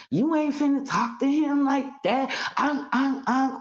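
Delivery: high, hammed-up mocking sister-in-law; mocking Malia. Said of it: "Here go Malia talk about".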